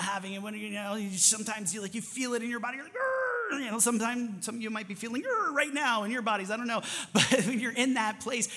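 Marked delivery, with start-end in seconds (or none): 2.9-3.2 high, strained growl of frustration; frustrated growl. Acted. 5.2-5.5 high, strained growl of frustration; frustrated growl. Acted.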